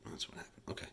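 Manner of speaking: whispered to himself; muttered discovery at the slides. Real, not acted. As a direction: breathe it as a quiet realization. Realizing a skipped slide.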